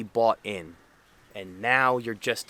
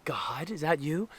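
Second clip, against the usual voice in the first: slow, higher-pitched comic question; movie-line impression; 5 semitones higher than usual. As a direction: quote the film line slowly, pitch lifted. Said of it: "uh, Zoolander, the movie?".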